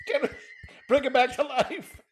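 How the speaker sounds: laughing so hard it sounds like crying; high, teary laughing voice; crying with laughter. Real, not acted. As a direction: quote it while wheezing with laughter. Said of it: "I'm crying".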